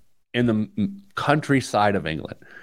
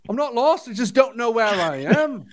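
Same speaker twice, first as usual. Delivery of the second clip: high Liverpool imitation, laughing at the end; Liverpool accent; 11 semitones higher than usual. Repeating the driver's line for laughs, in a Liverpool accent. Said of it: "his Liverpool accent".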